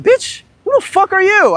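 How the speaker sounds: sharp high angry challenge; angry retort. Retold or imitated. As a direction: snap back at him, ready to fight. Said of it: "I was, like, ready to fight".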